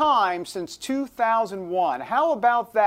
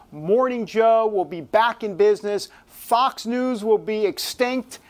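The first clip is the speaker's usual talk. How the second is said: normal talking voice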